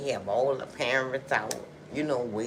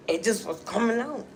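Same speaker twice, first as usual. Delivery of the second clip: ordinary delivery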